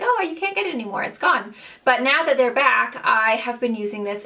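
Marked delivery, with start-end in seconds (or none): 0.0-1.6 high, fast, teasing put-on voice; quick, higher mock boast that trails off; mock smug reply. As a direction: lightly act out her own sly brag. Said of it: "And I'm like".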